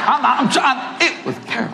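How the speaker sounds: ordinary delivery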